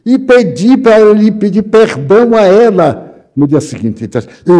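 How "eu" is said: loud, startled single word; shocked disbelief at the suggestion. Retold or imitated. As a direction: blurt it out, caught off guard. Man startled at being asked.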